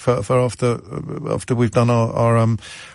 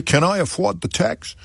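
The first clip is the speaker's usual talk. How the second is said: light mock New York accent, pitched higher; a hint of a New York accent; 8 semitones higher than usual. Host tries a New York accent reading a second-home owner. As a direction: try out a New York voice on the quote. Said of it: "Shall I do a new".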